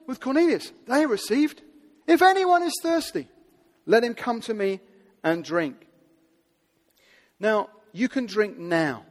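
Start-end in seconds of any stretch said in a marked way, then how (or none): 2.1-5.9 loud call. Retold or imitated. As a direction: cry it out to the whole crowd. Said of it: "in a loud voice".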